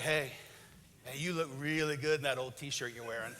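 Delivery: low, soft seductive line; flirty husband. Retold or imitated. Husband softly flirts with wife at bedtime. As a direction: croon it low to woo her. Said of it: "he's like".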